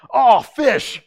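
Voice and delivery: loud high "Aw, fish!" exclamation; mock curse. Friend at Bible college swearing substitute, loud and high. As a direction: blurt it like a swear word. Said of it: "hear him around the Bible college go".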